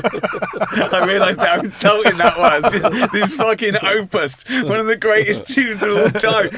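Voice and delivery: loud, slow words broken by laughter; laughing while talking. Real, not acted. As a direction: laugh through the line at the absurdity. Laughing at his own cheek.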